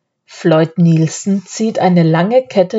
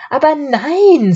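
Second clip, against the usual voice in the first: bright high-pitched protest; chirpy, carefree denial; a lightly voiced jolly pastor; cheerful; 9 semitones higher than usual. A narrator's reading. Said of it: "sagt der Pastor munter".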